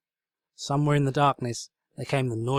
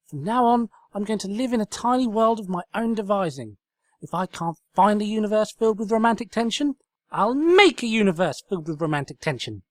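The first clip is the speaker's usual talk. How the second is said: loud, high defiant tirade; loud high-pitched vow; 10 semitones higher, 6 dB louder than usual. Acted. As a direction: declare it with mad resolve. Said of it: "force of her tirade".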